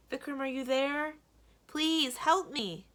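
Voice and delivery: high, loud desperate plea; frightened cry for help. Acted. Little girl locked behind a door, pleading in fear. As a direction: call out scared through the door.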